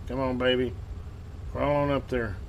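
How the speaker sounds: usual speaking voice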